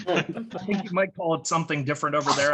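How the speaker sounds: ordinary talk, nothing marked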